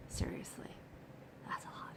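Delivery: hushed aside, no voice at all; breathy whispered aside to the camera. Real, not acted. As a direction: lean in and whisper it like a secret. Aside about weight lost.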